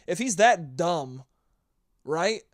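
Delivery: ordinary delivery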